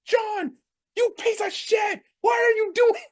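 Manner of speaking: high-pitched outraged commentator; Michael Cole, outraged. Retold or imitated. Commentator berating the wrestler, high-pitched outrage. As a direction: imitate an announcer losing it, voice shot way up. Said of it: "He even dropped, he's like".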